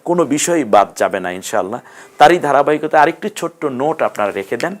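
ordinary talk

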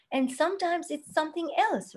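normal talking voice

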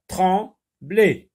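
"Trembler" in two separate beats; syllable by syllable. Shown as a demonstration. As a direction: read "Trembler" as if clapping each syllable. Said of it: "Lorsque tu dis une syllabe".

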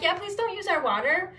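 high-pitched, sweetly polite refusal; retold nicey-nice plea; nice polite request. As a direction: say no as nicely as you can. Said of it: "like, in a nice way".

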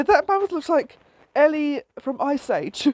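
usual speaking voice

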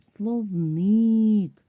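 "Словник" called out high and loud, last syllable drawn out; calling the word out. Shown as a demonstration. Teacher shows pupils where stress falls, "Словник" called out long. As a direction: hail "Словник" like calling someone, stretching the stressed ник. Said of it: "треба слово погукати".

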